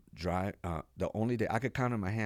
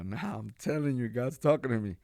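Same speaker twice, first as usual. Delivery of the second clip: higher, louder, pressing the point; insistent; 6 semitones higher, 6 dB louder than usual. Retold or imitated. Him insisting to his friends, loud and earnest. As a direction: convince them, earnest.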